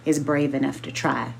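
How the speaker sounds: ordinary delivery, nothing marked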